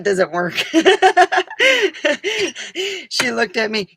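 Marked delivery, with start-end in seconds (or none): none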